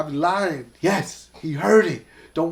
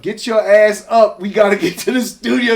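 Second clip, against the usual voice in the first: urgent retold order; 4 semitones higher, 9 dB louder than usual. Urgent call to Ashanti, retold.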